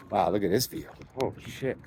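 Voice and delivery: ordinary talk, nothing marked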